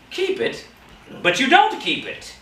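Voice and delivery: high-pitched, astonished objection; incredulous protest. A narrator's reading. Nephew objects to his uncle's claim, incredulous. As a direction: throw it back in high disbelief.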